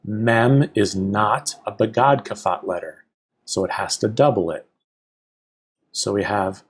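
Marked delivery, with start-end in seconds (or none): none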